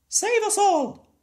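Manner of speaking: yelled. A narrator's reading. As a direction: scream from the window like a mad old neighbour. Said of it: "she yelled".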